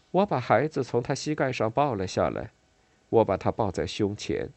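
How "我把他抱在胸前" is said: usual speaking voice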